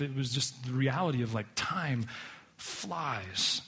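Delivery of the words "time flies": pause, then a drawn-out "flies"; softly stretched closing word; slow, weighty. Acted. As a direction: let it sink in, softer. Echoes the slow, weighty quote.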